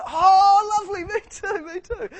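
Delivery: very loud, high mock rapture; over-the-top overjoyed cry, far above normal pitch; ecstatic agreement. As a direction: squeal with hammed-up delight. Acting escalating delight in the joke.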